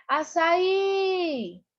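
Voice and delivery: high call, final í drawn out. Shown as a demonstration. Teacher demonstrating how to find the stressed syllable, calling out. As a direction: call açaí like someone's name, stretching the í. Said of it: "como se estivesse chamando uma pessoa".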